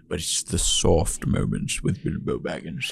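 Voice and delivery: hushed, breathy soft line; soft Gandalf moment. Shown as a demonstration. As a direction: speak it gently and low. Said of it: "the dramatic moments are easy".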